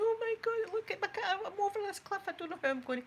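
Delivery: high-pitched retold panic, imitating herself; mock panic, voice jumping way up; panicked. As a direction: squeal it out like you're stuck on a cliff edge. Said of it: "I was in a mad panic".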